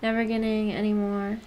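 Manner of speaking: usual speaking voice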